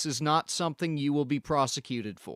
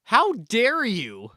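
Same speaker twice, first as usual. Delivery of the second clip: much louder and higher, then a slow how dare you; furious shout; 9 semitones higher, 8 dB louder than usual. Acted. Inspector to the homeowner, furious shout. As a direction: explode at the repeated insult.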